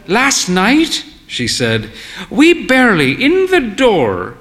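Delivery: put-on a proud old dear confessing lightly; genteel old mother. A narrator's reading. Mother telling their story.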